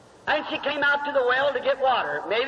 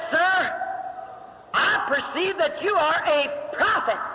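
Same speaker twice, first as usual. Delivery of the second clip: higher, slower, awed woman's voice; Samaritan woman; 4 semitones higher, slower than usual. Acted. Woman at the well addressing Jesus, raised slow awed voice. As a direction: let the woman speak with slow wonder.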